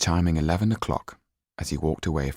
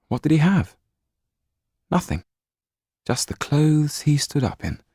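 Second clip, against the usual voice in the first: bitter despairing questions, raised high; self-pitying, louder and higher; 9 semitones higher, 5 dB louder than usual. A narrator's reading. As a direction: ask it with rising despair. Despairing inner thought.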